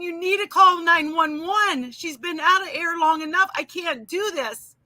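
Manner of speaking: high, mildly panicked plea of a retold mother; imitating crying mother. Mama begging nurse to save her choking baby, imitated tearful plea. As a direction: lightly act out a distraught mom pleading. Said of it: "looked at her mama and crying".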